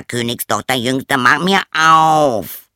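insist, stretching every word; frog demanding. Acted. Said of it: "eine Stimme rief".

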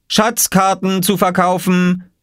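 slow, high street-seller's call. A narrator's reading. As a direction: cry your wares like a boy. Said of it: "rief Oskar". (langgezogener, hoher Ausruf)

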